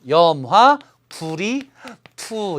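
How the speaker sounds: 염화 held out, pauses before 불이 and 투; part-by-part reading. Shown as a demonstration. Teacher reading the copper compound for students, part by part. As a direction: break 염화, 불이, 투 into separate beats. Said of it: "이렇게 읽겠죠".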